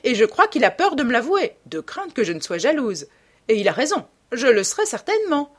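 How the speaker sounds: lightly exclaimed, with swooping pitch; exclaiming. A narrator's reading. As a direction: carry on the outcry, animated and emphatic. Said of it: "s'écria lady Narborough".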